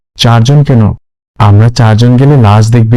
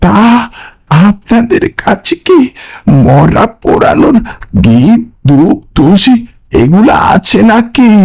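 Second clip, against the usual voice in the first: much higher, uncanny ghostly voice; eerie stranger; 10 semitones higher, slower than usual. Acted. Mysterious stranger greets the friends at the pyre, high and uncanny. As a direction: sound sly and otherworldly. Stranger asks about rites.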